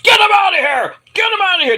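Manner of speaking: high, strained mock shout; mock angry shout. Shawn ordering Waller out backstage, high angry mock shout. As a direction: bellow the order as an exasperated boss.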